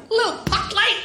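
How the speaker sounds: high sweet "Little puck light"; mock cutesy. Real, not acted. Describing the wanted tiny light, cutesy high voice.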